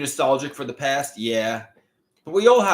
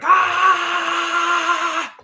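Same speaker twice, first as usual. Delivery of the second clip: sudden high scream; 5 dB louder than usual. Pretend. Wanting love, screaming in a library. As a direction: let out a piercing shriek. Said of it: "start screaming like".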